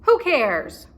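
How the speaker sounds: gruff pirate boss roaring; roaring captain. Acted. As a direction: bark it out as the captain. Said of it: "roared Captain Bones".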